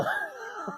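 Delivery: high unvoiced shriek of disgust. Real, not acted. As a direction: recoil with a squealing gasp. Gagging at mayonnaise smell.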